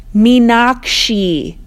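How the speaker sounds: "Meenakshi" loud, slow, drawn out; brayed name. Acted. Narrator braying the name over the rain, annoyed. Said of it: "having to bray her name like a donkey".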